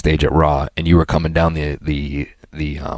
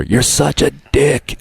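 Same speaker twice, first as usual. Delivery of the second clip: higher, drawn-out imitated yell; mock distant yell; 9 semitones higher, much slower than usual. Imitating a friend yelling as he runs away, mock call. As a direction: stretch it out as if calling down a corridor. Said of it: "I hear yelling down the corridor".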